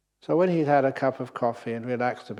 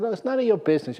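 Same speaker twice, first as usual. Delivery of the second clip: sharp, high angry outburst; father shouting; 9 semitones higher, 5 dB louder, much faster than usual. Acted. Tired father snaps at his son, angry shout. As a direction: bark it at the nagging kid. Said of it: "the father shouted at his son".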